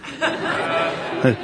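breathy laugh. Real, not acted. Laughs at own joke.

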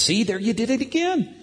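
high-pitched mock gotcha; teasing accusation. Dad catching his son laughing, high teasing. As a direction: catch him out playfully, pitch up.

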